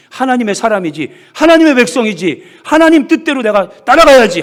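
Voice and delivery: 점점 높고 크게 외치는 다짐; 믿음의 다짐을 점점 크게 쌓아 올리기; 고조되는 다짐. Acted. (성도에게 보이는 다짐, 점점 높고 크게)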